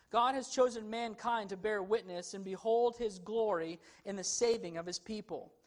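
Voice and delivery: ordinary talk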